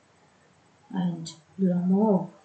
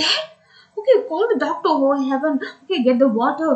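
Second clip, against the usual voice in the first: higher, urgent acted orders; panicked; 5 semitones higher, faster than usual. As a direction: fire off orders in a flap. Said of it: "he became panicked".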